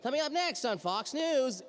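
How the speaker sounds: high-pitched, slow, off-target promo read; botched announcer read. Shown as a demonstration. Voice actor hearing a playback gone wrong, botched announcer read. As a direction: do a bad, high news-bumper voice. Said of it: "and you sound like".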